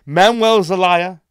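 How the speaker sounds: loud sharp voice; yelling. Real, not acted. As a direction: shout out each name and country. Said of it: "sorry for yelling so loud".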